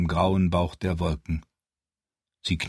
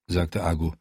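plain reading voice

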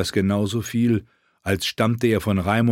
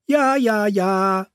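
fool voice; 12 semitones higher, much slower than usual. Acted. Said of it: "klingelte mit den Glöckchen".

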